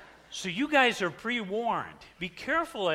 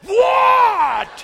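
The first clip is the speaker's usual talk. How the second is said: very high, loud laughing shout; shocked shout; 15 dB louder than usual. Real, not acted. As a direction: shriek it in comic shock. Punchline to congregation.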